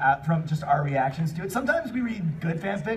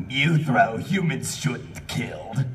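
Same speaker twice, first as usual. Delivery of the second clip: slow hammed-up Eevee; 6 semitones lower, much slower than usual. Reading Eevee's broken dialogue.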